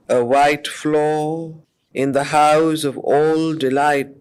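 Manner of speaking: slow, measured reading of epic verse; solemn poem reading with long pauses; poetry recitation. Acted. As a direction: let each line of the poem hang in the air. Recites verse passage slowly.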